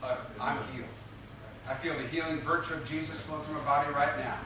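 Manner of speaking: hushed self-affirmation; uttering to oneself. Shown as a demonstration. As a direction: utter it quietly as a personal affirmation. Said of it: "you utter".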